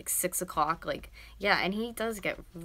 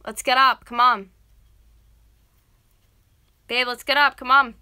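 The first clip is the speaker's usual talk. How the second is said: very loud, raised-pitch urging; yelling; 5 semitones higher, 16 dB louder than usual. Real, not acted. Girlfriend urging boyfriend out of bed, yelling. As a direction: rouse him with loud repeated prods. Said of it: "I yell at him like that to get up".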